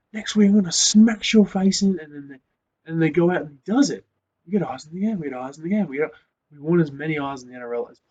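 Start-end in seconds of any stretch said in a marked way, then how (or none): 0.1-2.0 higher and much louder, a hammed-up fighter's threat; mock trash talk threat. An outspoken player threatening his rival, mock angry threat. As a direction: taunt an opponent before the match. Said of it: "he came out and said".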